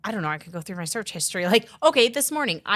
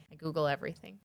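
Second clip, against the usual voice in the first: quiet repeat; 4 semitones lower, 9 dB quieter, faster than usual. Shown as a demonstration. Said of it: "Like, I Google everything".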